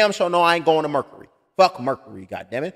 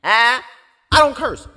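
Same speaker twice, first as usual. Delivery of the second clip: normal talking voice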